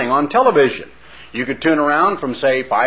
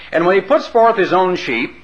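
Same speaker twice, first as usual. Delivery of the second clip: ordinary delivery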